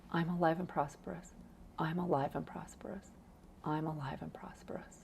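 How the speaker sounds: very soft, fading into a whisper; bedtime murmur. Shown as a demonstration. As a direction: say it to yourself as you drift off. Models last mantra before falling asleep.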